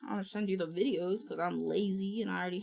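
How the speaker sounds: ordinary talk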